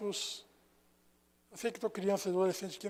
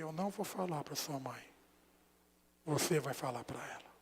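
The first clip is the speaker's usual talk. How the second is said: gentle reassurance; 6 semitones lower, 8 dB quieter than usual. Retold or imitated. Pastor reassures crying boy.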